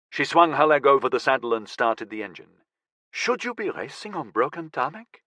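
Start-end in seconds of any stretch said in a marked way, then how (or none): 3.1-5.2 put-on soft, higher voice with a light French accent. A narrator's reading. Girlfriend questions a racer about broken tarmac, light French accent. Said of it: "asked in a light French accent".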